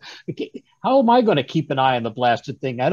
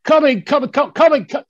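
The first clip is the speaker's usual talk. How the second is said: higher and louder, hurried repeats of coming; flustered calling out; 9 semitones higher, 7 dB louder than usual. Acted. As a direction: Watson hurrying in when called, flustered and raised. Watson hurrying in when called.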